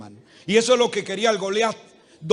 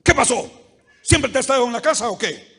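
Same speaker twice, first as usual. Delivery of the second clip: loud, demanding husband, clearly louder; harsh husband; 4 semitones higher, 9 dB louder, faster than usual. Acted. Husband barking at his wife about dinner, loud and harsh. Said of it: "Siempre le habla así".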